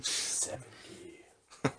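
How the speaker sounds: slow, hushed trailer announcer; movie trailer voice. Acted. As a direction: drop the tagline softly, with big pauses. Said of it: "it's like a commercial".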